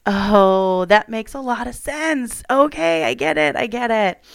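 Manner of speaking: light retold aha moment, rising high; sudden realization. Sudden realization after getting period.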